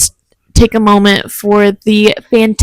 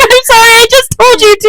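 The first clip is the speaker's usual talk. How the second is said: much higher, louder put-on mom voice; apologetic mom; 13 semitones higher, 5 dB louder than usual. Friend voicing the mom apologizing to her child, high and loud. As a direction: gush out a guilty sorry. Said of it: "She's like".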